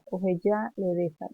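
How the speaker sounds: usual speaking voice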